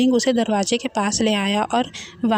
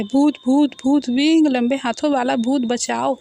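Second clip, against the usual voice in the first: panicked cry for help; alarmed wail, easing slightly towards the end; terrified yelling; 3 semitones higher than usual. A narrator's reading. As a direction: cry out in fright, voice raised. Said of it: "वांगजी चिल्लाने लगता है".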